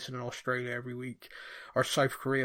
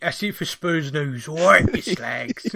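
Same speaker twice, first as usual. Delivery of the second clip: put-on loud, cheeky London accent; 3 semitones higher, 12 dB louder than usual. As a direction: bellow it like a cocky Londoner. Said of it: "doing your London accent that you do".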